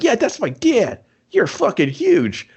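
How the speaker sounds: put-on high, slow awestruck kid voice; mock kid in awe of his dad; excited kid. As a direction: gush up high like a starstruck kid.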